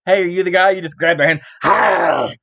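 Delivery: hammed-up fan and bite; playful mock fan bit. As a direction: act out the biting bit with glee. Acts out fans being bitten.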